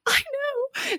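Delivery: very high, soft squeaky "I know"; fangirl squeal. Retold or imitated. Host replying to her husband, high fangirl squeak. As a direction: squeak it like an overexcited fan. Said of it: "And I was like".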